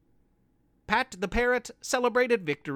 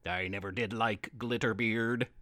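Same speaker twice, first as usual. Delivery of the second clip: low, growling pirate Pete; menacing pirate vow, low; gruff pirate voice; 9 semitones lower, 6 dB quieter than usual. Acted.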